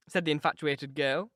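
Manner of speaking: ordinary delivery, nothing marked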